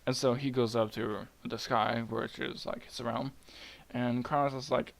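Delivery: usual speaking voice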